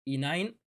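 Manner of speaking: Inine said as "ee-NINE"; chosen pronunciation. Shown as a demonstration. Streamer settles on an easier name for the hero, chosen pronunciation. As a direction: say Inine slowly, like the letter E plus nine. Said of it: "what I'm going to call".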